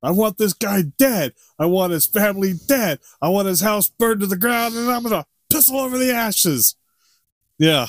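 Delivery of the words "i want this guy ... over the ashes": high-pitched ranting mob boss; Robert De Niro as Al Capone. Acted. Mob boss demanding revenge, Al Capone impression. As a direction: build a gangster's vengeful tirade, pitch climbing. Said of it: "like Robert De Niro in The Untouchables where he plays as Al Capone".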